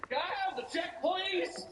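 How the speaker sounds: high, timid request for the check; high mock-polite voice. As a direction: pitch way up like a nervous tourist. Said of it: "And he's just like".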